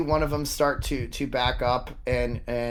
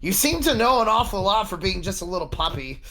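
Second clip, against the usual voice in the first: nervous guard voice; 7 semitones higher, 4 dB louder, faster than usual. Acted. Guard nervously challenges player.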